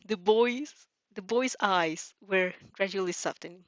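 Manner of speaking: usual speaking voice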